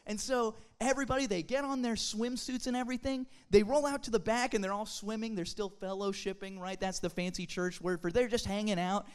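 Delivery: ordinary talk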